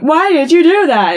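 high, loud incredulous question; mock dismay. Acted shocked question in recap.

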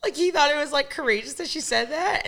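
ordinary talk, nothing marked

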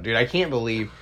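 ordinary delivery

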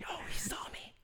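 high, urgent whisper. Retold or imitated. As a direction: hiss it so he won't hear. Mock panicked fan whisper.

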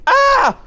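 high loud yelp; startled yelp. Acted. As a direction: cry out in shock. Cry of surprise.

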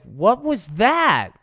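high, loud startled question. Acted. Rudolph startled by object overhead.

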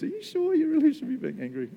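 very high, querying tone; mock sceptical questioning. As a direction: ask it like a doubtful check. Voices God gently questioning Jonah.

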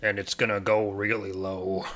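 forced low, deep voice. Shown as a demonstration. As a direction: push the voice as deep as it goes. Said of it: "unless I do something like this".

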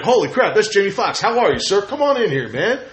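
gushing mock poll worker; starstruck welcome. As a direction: act thrilled to greet a celebrity. Voices poll workers welcoming a star.

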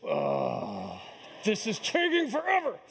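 dad left waiting on the pitcher's mound, playfully exasperated whine; mock exasperated groan. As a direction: moan it like a bored dad stuck waiting. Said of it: "I'm standing alone on the pitcher's mound like this".

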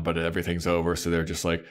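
normal talking voice

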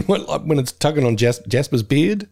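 ordinary delivery

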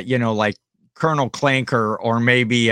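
normal talking voice

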